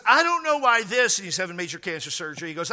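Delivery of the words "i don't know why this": high, loud, aggrieved retold complaint; raised-voice quote of a baffled man; loud protest. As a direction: imitate his indignant protest, pitched well up. Retelling man's protest before cancer surgery.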